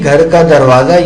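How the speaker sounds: usual speaking voice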